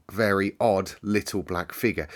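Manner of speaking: ordinary delivery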